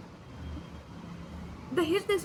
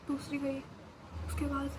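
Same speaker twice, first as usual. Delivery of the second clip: ordinary talk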